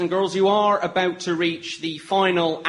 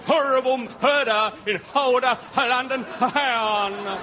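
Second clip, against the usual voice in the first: higher calling out like a street paper seller; newspaper boy cry; 7 semitones higher than usual. Acted. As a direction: hawk the headline to the street.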